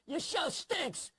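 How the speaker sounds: high, drawn-out shout from across the street; imitating a stranger heckling from afar; heckler's yell. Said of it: "some guy yells out from the bus stop".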